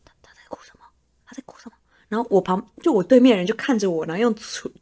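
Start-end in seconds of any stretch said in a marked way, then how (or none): 0.0-1.8 unvoiced whisper at a meeting. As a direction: breathe the question so the colleague won't hear. Whispering beside crying colleague in meeting. (开会时悄声耳语)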